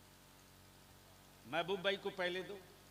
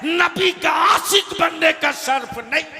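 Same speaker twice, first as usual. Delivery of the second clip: loud, high, ringing sermon delivery; full-force preaching; 9 semitones higher, 20 dB louder, slower than usual. Real, not acted. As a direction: preach it out to a big crowd. (पूरे जोश में तक़रीर)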